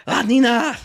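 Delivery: high, loud French cry of "Ah, Nina!"; French accent. Retold or imitated. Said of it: "They'd be like".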